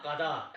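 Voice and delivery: कदा with the long आ kept at the end; wrong reading कदा, ending in an open aa; full final vowel. Shown as a demonstration. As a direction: sound both syllables of कदा fully. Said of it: "ऐसा नहीं करेंगे".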